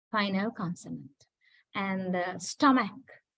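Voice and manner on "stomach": "stomach" ending in a k sound; model pronunciation. Shown as a demonstration. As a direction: say "stomach" with a final k, not as spelt. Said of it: "don't go by the spelling".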